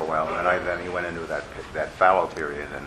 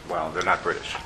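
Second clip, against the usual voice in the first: ordinary talk, nothing marked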